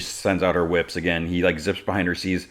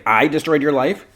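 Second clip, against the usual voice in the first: higher, louder mock indignation; indignant disbelief; 9 semitones higher, 7 dB louder than usual. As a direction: throw the accusation back, incredulous. Throws the accusation back.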